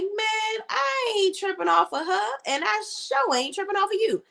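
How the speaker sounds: high, dismissive Zach impression; imitating Zach. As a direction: brush him off, unbothered. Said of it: "And Zach was like".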